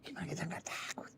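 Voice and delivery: hushed, fearful whisper of the disciples' thoughts; inner whisper. Acted. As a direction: whisper it as a secret worried thought. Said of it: "Tapi dalam hati murid-muridnya". (bisikan batin yang cemas)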